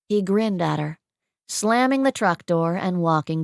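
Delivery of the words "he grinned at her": plain reading voice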